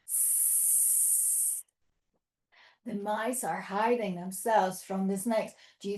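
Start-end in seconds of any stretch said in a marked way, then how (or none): 0.1-1.6 long, soft snake hiss. Pretend. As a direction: let out a steady sss like a snake. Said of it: "hiss like a snake".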